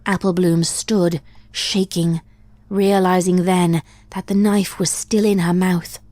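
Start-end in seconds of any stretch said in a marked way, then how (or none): none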